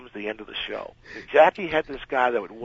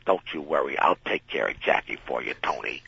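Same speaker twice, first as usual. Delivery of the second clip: lowered conspiratorial voice; 9 semitones lower than usual. Retold or imitated. Actress voiced reassuring him.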